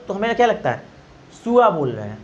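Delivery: ordinary delivery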